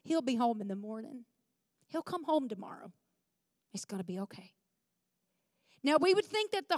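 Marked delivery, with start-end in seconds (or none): 0.0-4.5 soft, unhurried reassurance with long pauses; Dallas reassuring. Retold or imitated. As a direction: imitate a calm husband, quiet and settled. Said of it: "Dallas told me".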